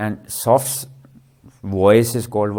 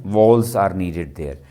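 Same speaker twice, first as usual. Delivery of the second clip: usual speaking voice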